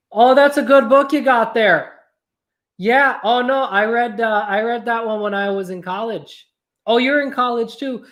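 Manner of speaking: chatty Midwestern neighbor, lightly done; bright neighborly Midwestern small talk; Midwestern accent. Retold or imitated. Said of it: "it just felt really right to go to a Midwestern accent".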